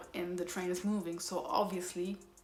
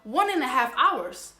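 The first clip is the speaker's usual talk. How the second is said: exasperated outburst; 5 semitones higher, 10 dB louder than usual. Real, not acted. Exasperated about long train wait.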